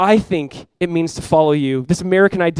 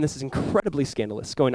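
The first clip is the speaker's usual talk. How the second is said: ordinary delivery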